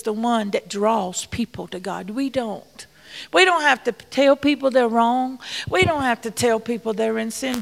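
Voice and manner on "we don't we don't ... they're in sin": ordinary talk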